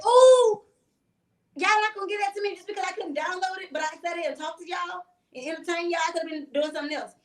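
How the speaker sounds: high, loud indignant protest that fades down; mock outrage, pushing back; indignant outburst. Retelling her protest to the strangers, high and indignant. Acted outrage at denied water bottle.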